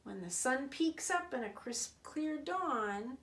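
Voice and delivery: ordinary delivery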